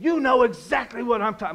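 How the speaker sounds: mock the irate customer's accusation; angry caller. Pizza manager imitating the customer who screamed at him, angry and loud.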